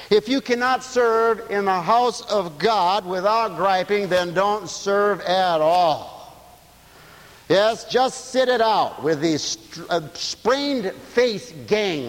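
slow, weighty preaching; slow emphatic preaching. Real, not acted. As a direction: drive each word home slowly.

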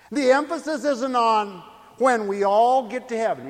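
loud, emphatic preacher with weighty pauses; full-force preaching. Real, not acted. Preacher explaining the calling to belong to Christ, loud and emphatic. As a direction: drive the point home to the congregation.

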